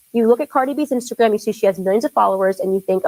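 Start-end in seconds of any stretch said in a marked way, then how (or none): none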